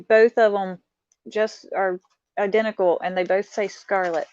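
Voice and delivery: ordinary talk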